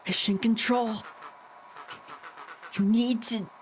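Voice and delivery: usual speaking voice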